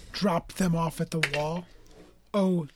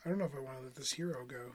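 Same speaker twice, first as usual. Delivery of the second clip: quiet, fast, face down; inaudible mumble; 5 semitones lower, 13 dB quieter, faster than usual. Real, not acted. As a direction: mumble into the table. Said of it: "that wasn't super, uh, audible".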